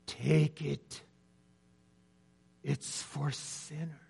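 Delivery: put-on gentle Scottish accent, pastor's plea; softly urging in a Scottish accent. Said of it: "in his Scottish accent said".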